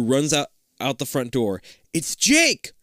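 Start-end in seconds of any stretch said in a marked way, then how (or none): 1.9-2.7 high, loud It's Jake!; cry out. Acted. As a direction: call out spotting him. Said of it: "Liz cries".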